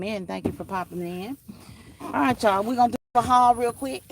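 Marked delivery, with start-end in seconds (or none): 2.0-4.0 excited. Real, not acted. Hyping viewers for the haul.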